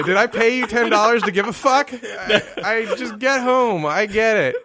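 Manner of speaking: loud, high, laughing outrage; indignant rant. Acted. Mock indignant rant at the vet.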